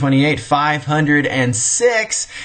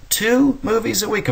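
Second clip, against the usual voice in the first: astonished; 11 semitones higher than usual. Real, not acted. Lecturer marvels to students at moviegoing, astonished high. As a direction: voice leaps high in disbelief. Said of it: "this astounded me".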